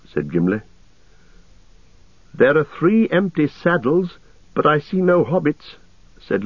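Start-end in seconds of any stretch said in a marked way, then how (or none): 2.3-5.8 high, lilting elf voice; elven scout. A narrator's reading. Legolas reports the distant riders, high elf voice. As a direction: report the sighting in a raised, light pitch.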